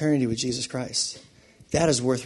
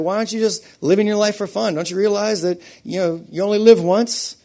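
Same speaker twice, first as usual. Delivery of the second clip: higher-pitched scoffing at a believer; ridiculing; 6 semitones higher, 4 dB louder than usual. Acted. Critics needling a believer about living for fun, ridiculing. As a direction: scoff with rising disbelief. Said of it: "people ridicule you and they're like".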